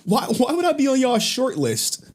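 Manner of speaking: high, loud, incredulous yelp; shocked disbelief. Retold or imitated. Telling the insider he's on their list, shocked and high. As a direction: squeal it out like you can't believe it.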